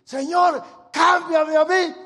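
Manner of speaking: high, loud, slow plea; fervent shouted prayer. Shown as a demonstration. Preacher showing the church how to pray, loud slow plea. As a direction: cry it out to heaven. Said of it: "eso no se nos ocurre decir".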